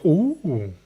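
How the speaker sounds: soft, drawn-out ooh; admiring ooh. Retold or imitated. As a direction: coo in admiration. Customers admire the finish.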